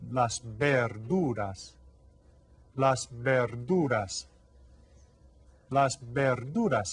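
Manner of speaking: "las verduras" slow and drawn out; slow model. Shown as a demonstration. Teacher drilling las verduras slowly with kids. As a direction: say "las verduras" slowly, three times over. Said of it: "they are".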